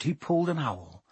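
ordinary delivery

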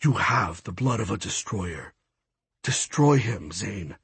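low, hushed, softly urging voice; whispering god. A narrator's reading. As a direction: urge the hero on in a hushed tone. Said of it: "Huracan's whispering voice found me".

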